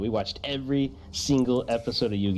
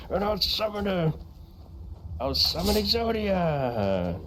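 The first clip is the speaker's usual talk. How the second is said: duelist voice; 6 semitones higher, slower than usual. Pretend. Exodia duelist.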